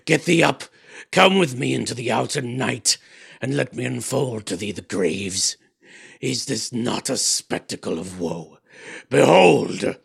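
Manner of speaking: loud, rising command, ending in a shout; commanding fiend. A narrator's reading. Fiend ordering the sleeper to behold the graves, shouting. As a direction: summon him out to the graves.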